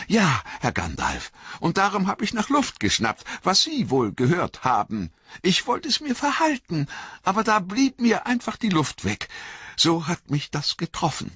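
high-pitched servant's confession; heartfelt, high, simple-hearted voice; Sam, earnest and shaken. Acted.

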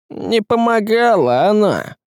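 slow, high stern woman's voice. A narrator's reading. Woman contradicting the sorcerer, stern. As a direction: pronounce it severely, drawn out. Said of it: "сурово сказала Ярогнева".

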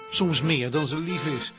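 normal talking voice

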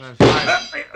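hoch und laut gerufen; lauter Ruf. Acted. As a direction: betrunken herausgeschrien. Betrunken laut nachgerufen. (betrunken lauter Ruf)

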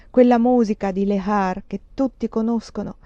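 plain reading voice

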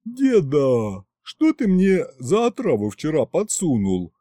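sleepy yawning question. A narrator's reading. Narrator yawns at grandpa next morning. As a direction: talk through a yawn. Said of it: "Зевая, с завываниями".

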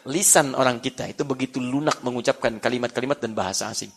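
ordinary talk

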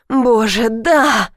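mild cry of passion; restrained exclamation of abandon. A narrator's reading. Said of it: "выкрикнула я".

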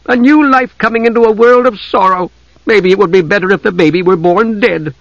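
high, bitter lament. A narrator's reading. Desra bitterly despairing over war and birth. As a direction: spit out her despair. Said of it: "Desra said bitterly".

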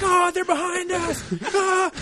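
high, loud laughing shout; panicked yell. Retold or imitated. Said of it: "just being like".

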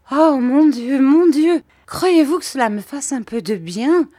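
high, loud, despairing lament; distraught. A narrator's reading. Mother doubts tea will help her, distraught. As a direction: wail it, ending on a pleading question. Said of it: "d'un ton désolé".

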